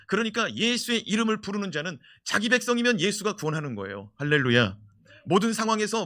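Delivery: loud, high preaching; fiery preaching. Acted. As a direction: drive it home to the church. Preaching at full force. (열정적인 설교 톤)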